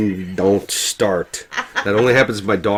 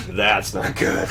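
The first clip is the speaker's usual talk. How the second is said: deadpan worry; 4 semitones lower, 5 dB quieter, slower than usual. Retold or imitated. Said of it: "And he's like".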